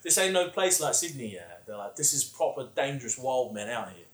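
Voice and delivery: put-on a light English accent, blokey and casual. Aussie retelling English mates' warning in a light English accent. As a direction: mimic the English lads with a hint of their accent. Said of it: "so good at the English accent".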